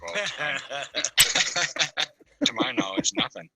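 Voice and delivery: laughing through the words. Retold or imitated. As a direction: giggle out the answer, voice jumping up. Laughing while quoting himself.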